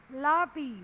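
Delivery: ordinary delivery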